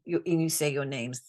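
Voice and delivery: ordinary talk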